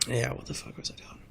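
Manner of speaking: under-the-breath whispered aside; whispering. Real, not acted. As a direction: whisper it to yourself. Muttered aside to himself.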